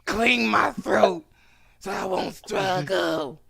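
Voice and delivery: slow, drawn-out strained voice; hammed-up wrecked voice, dragging each phrase; mock monster lover. Joking bedroom imitation of woman.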